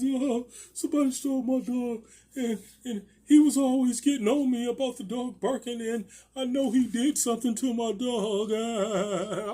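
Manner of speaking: high, wailing imitation of tearful complaint; mock crying. Neighbour crying to police about her dog, mock wailing. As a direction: exaggerate her blubbering, stretching into a wail. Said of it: "she crying to the police".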